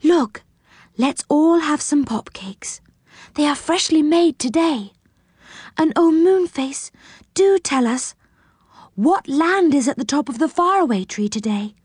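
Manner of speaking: put-on a light, coaxing fairy girl; gentle girl voice. A narrator's reading. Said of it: "she said".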